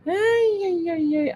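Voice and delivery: high singsong mock exasperation; teasingly fussing; lilting high ay-ay-ay; playful ay ay ay. Real, not acted. Playful exclamation after laughing.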